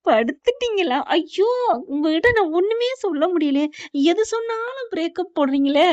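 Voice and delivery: high-pitched, put-upon complaint; exasperated protest. Acted. Mathi objects to the teasing listener, exasperated protest. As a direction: protest at being misread. Teased speaker protests at interruption.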